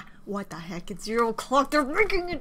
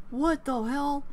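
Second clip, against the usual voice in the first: normal talking voice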